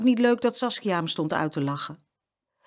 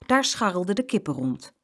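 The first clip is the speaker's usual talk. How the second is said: plain reading voice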